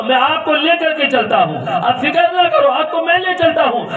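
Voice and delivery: high, loud storytelling sermon; preacher voicing the harsh son; fiery preaching. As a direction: preach it out with rising fervour. Preaching the story at full force.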